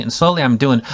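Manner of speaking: ordinary talk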